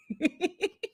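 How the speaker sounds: high giggle; light giggle. Real, not acted. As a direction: laugh at the joke. Laughing at own joke.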